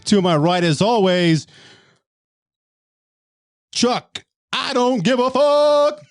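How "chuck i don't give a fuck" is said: hype announcer. Acted. Comic intro announcement.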